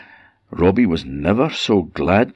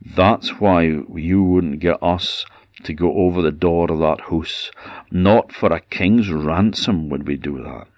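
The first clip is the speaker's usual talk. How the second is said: plain reading voice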